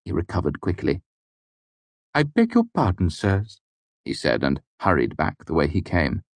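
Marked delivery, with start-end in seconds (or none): 2.1-3.6 higher, flustered servant voice; nervous Stapes. A narrator's reading. Caught manservant to master, higher flustered apology. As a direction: apologize in a rush, voice pitched up.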